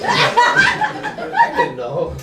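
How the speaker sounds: words squeezed out through a high laugh; laughing too hard to talk; stretched, giggling confession. Real, not acted. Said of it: "You have a contagious laugh".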